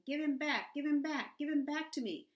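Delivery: loud, high, furious demand repeated; shouting. A narrator's reading. Louisiana screaming at the shelter woman for her cat, furious shout. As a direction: yell it over and over, kicking the desk. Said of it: "where Louisiana was shouting".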